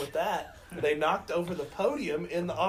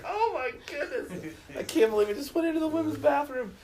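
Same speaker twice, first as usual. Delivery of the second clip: high, laughing disbelief; retold embarrassed shock; 11 semitones higher, 3 dB louder than usual. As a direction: laugh it out, mortified. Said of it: "I was like".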